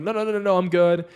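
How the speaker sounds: high, loud rushed no no no; panicked refusal. Retold or imitated. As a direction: brush off her luggage offer in a panic. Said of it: "I'm like".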